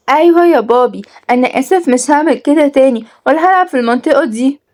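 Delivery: put-on high little-boy voice; apologetic child. A narrator's reading. Son promising father he will behave, high and apologetic.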